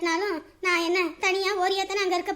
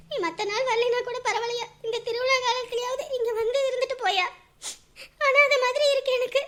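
higher, tearful pleading through sobs; crying; 5 semitones higher than usual. Acted. Lonely mother cries on the phone to her son, crying. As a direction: beg him to come home while crying. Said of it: "சரிம்மா, அழாதீங்க".

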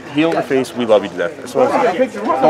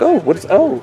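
put-on baby talk to dog; slower than usual. Greeting a stray pit bull.

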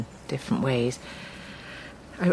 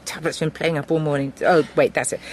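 usual speaking voice

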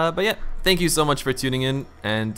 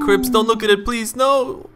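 mock panic; 10 semitones higher, 3 dB louder, slower than usual. Real, not acted. Pleading at spooky sight.

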